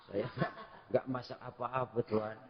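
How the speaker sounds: pelan sekali, lirih; suara ibu takut. Retold or imitated. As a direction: jawab pelan seperti ibu yang takut. Ibu menjawab pelan ketakutan. (ibu ketakutan menjawab khalifah dengan lirih)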